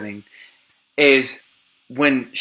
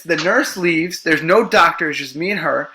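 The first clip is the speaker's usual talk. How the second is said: ordinary delivery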